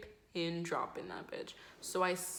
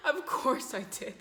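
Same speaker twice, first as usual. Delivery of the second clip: pitched up through real laughter; laughing self-mockery; 5 semitones higher than usual. Real, not acted. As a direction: crack up at your own luck. Laughing at her own bad timing.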